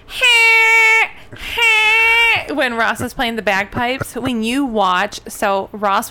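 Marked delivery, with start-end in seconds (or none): 0.1-2.5 very high, loud strained wail; piercing high-pitched mock wail; Phoebe's bagpipe cry. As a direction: mimic a squealing bagpipe harmony. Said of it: "Phoebe going".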